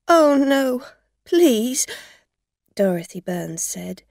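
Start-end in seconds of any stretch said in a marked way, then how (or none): 0.1-1.9 pleading. Acted. Dorothy begs.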